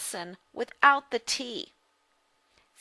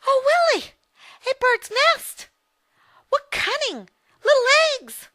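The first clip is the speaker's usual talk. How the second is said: put-on much higher, louder child voice; girl Rose excited; 8 dB louder than usual. Little Rose shows her brother a nest, high and excited.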